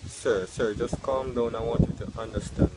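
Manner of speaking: a deep, patient cashier brushing him off; calm counter worker. Acted. Worker calming angry customer.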